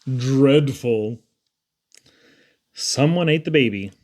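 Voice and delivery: plain reading voice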